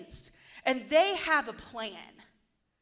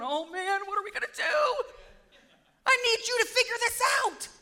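high, loud, hammed-up panicked wail; mock panic; 9 semitones higher, 5 dB louder than usual. Preacher mimicking God not knowing what to do, mock panic. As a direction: play frantic helplessness, pitch shooting way up.

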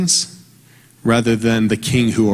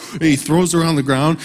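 ordinary talk

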